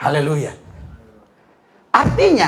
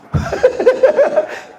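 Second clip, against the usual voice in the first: high burst of laughter. Real, not acted. Laughing at sitting believers.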